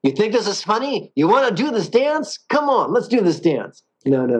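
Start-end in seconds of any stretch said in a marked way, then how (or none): 0.0-1.1 much higher, mock-belligerent New Jersey tough; angry New Jersey guy. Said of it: "an angry guy from New Jersey". 1.1-3.8 much higher, mock-belligerent New Jersey tough; angry New Jersey guy. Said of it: "an angry guy from New Jersey".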